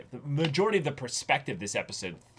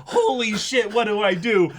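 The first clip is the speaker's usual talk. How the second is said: frantic, much higher and louder; mock panic; 10 semitones higher, 8 dB louder than usual. Host voicing the character's stage fright, frantic panic. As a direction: spiral into frantic repeated whats. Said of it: "which is".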